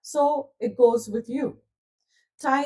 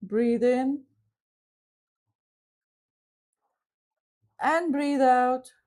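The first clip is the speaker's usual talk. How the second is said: slow guided breathing, long pauses; guided visualization voice, slow on the breaths; guided meditation. Acted. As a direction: lead them gently through the breath. Leads a visualization.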